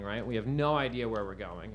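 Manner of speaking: ordinary talk, nothing marked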